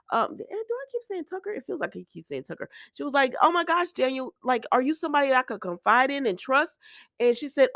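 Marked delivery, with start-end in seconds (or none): 3.4-6.8 retold distress, pitched well up; mock hyperventilating panic. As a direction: imitate her emotional plea, higher. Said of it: "So now Summer hyperventilating".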